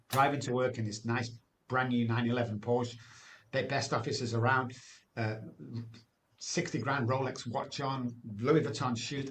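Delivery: ordinary delivery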